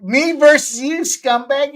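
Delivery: loud, high taunting call. Pretend. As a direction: rub it in his face. Trash talk between co-hosts.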